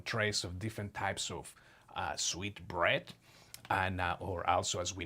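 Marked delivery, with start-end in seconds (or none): none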